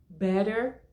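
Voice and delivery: better com T como R suave, estilo americano; American better. Shown as a demonstration. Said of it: "Quatro opções pra você escolher". (better com o T americano batido, soando como "bérer")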